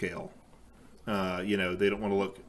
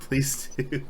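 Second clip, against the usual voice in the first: normal talking voice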